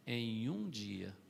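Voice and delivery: Em um dia drawn out very slowly; preacher stressing a single day, slowly; slow emphasis. Acted. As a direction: let Em um dia sink in slowly. Repeated for emphasis.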